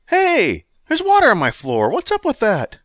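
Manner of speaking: high, loud outburst; Gary startled by the flood; startled protest. Acted. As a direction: react to water on the floor. Gary's floor gets wet.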